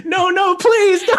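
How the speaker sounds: high terrified pleading shout; panicked shout. Acted. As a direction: scream no as the horse closes. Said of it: "as the horse is getting close".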